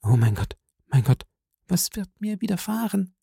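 hushed, fearful plea; low voice. A narrator's reading. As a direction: murmur it in quiet dread. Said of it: "sagte sie mit leiser Stimme".

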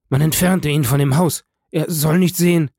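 loud, high, frantic command; panicked order. A narrator's reading. Father orders servants to keep his son away, panicked.

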